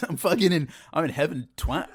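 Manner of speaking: loud cheeky boast; a playful brag from heaven; cheeky heavenly voice. Pretend. Voicing self in heaven, cheeky.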